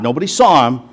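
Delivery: ordinary talk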